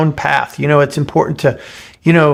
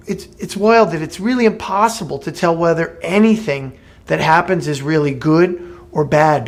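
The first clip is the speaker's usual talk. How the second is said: usual speaking voice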